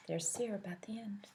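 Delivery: soft whisper to listeners; the reader pointing at the picture; whispered aside. Shown as a demonstration. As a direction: point it out in a hush. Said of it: "aside to listeners".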